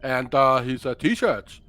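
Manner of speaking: put-on Danish accent, evenly delivered. Shown as a demonstration. Co-host showing how the musician really speaks, Danish accent. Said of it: "speaks more like this".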